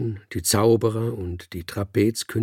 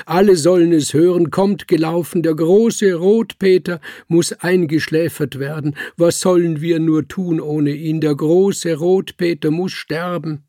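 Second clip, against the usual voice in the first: higher and louder, a fast then steady wailing call; loud lament; 8 semitones higher, 8 dB louder than usual. A narrator's reading. Director calls the circus to Rotpeter's deathbed, loud lament. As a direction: summon everyone with a lament. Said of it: "laut und klagend".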